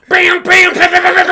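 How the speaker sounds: high, loud rapid-fire "bam" sounds; loud mimicked blows; imitated fight noises. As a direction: fire off excited action sound effects. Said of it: "Luke's like".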